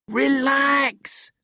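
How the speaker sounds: ordinary delivery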